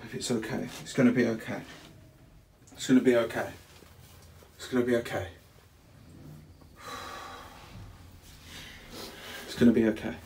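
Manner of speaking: calm a frightened partner, over and over; reassuring. Real, not acted. Comforting scared fiancee in stuck lift, reassuring.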